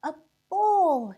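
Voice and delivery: high, loud gleeful cry; milkmaid's shout. A narrator's reading. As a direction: shout it out to her sister. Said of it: "shouted a milkmaid".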